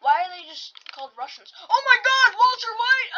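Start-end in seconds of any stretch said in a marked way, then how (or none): 1.6-3.1 high, loud delighted exclamation; excited surprise. Real, not acted. Gamer spotting a famous character, excited. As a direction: gasp out the name in surprise.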